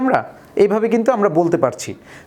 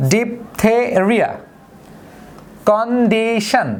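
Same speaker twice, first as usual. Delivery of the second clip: slow, each word split into its three syllables; much slower than usual. Shown as a demonstration. Teacher slowly dividing example words into syllables. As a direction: tap out every syllable slowly. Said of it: "তাকে ট্রাইসিলেবল বলে".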